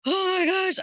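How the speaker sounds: high, loud panicked oh my gosh; child seeing blood, high panicked exclamation; panicked gasp. Retold or imitated. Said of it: "I was like".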